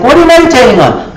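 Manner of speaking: high and fast nosy question; prying visitor. Retold or imitated. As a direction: rattle it off, pestering.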